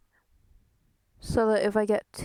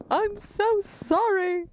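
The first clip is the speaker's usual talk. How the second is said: drawn-out mock apology; 9 semitones higher, slower than usual. Apologizing to chat for mic noise.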